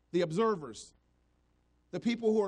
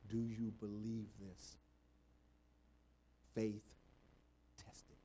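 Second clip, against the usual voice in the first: low intimate storytelling from the pulpit; hushed preaching; 9 semitones lower, 12 dB quieter than usual. Acted. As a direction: draw them in with a near-murmur. Hushed retelling of Jesus' words.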